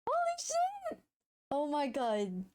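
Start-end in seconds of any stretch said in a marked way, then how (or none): 0.1-1.0 excited squeal. Real, not acted. Celebrating the commission.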